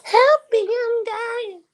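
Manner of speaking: high, hammed-up wail of someone stranded; mock cry for help. Mocking a man stuck on the mountain, high fake wailing. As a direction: squeal it like a helpless man on a mountaintop. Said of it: "She said that's insensitive".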